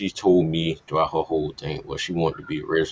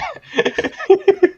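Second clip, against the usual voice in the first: high burst of laughter; 7 dB louder than usual. Real, not acted. Still laughing at own joke.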